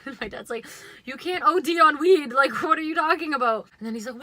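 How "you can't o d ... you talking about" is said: loud, high mock outburst at her; imitated incredulous dad. Dad scolding his stoned kid, incredulous mock yell. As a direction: raise your voice as an exasperated dad, pitched up. Acting out angry dad's disbelief.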